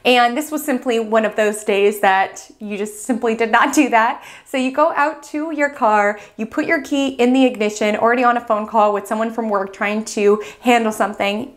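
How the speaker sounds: ordinary talk, nothing marked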